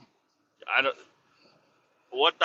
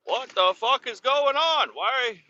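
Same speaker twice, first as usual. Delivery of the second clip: very high, incredulous mock outrage; exasperated protest; 10 semitones higher than usual. Driver's imagined protest to scale house girl, mock exasperated. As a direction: protest in disbelief, voice pitched way up.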